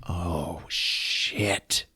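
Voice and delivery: whispered oh shit. Retold or imitated. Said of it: "he's like".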